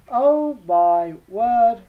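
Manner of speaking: loud, drawn-out dismay at a hard choice; agonised exclamation. Real, not acted. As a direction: stretch each word out, loudly. Agonising over a difficult choice.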